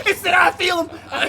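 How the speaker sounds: high, loud hammed-up fright; laughing mock panic; mock panicked shout. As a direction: imitate a spooked friend yelling. Acts out the spooked friend's panic.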